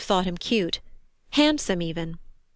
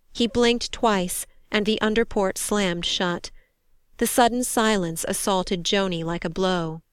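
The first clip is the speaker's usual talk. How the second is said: plain reading voice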